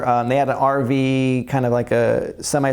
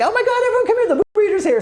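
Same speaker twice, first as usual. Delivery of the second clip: high, laughing shout to the group; squealing with excitement; excited call; faster than usual. Retold or imitated. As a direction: call everyone over in delight. Excited friend calling everyone over.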